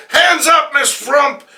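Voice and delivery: loud, high command; police order. Acted. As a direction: bark the arrest order. Police burst in.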